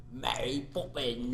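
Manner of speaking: slow, hammed-up film Cockney; thick Cockney accent. Imitating the chimney sweep actor, thick Cockney accent. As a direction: mimic an American actor's overdone Cockney. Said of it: "thick accent".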